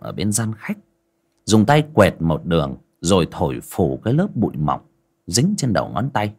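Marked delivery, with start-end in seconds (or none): none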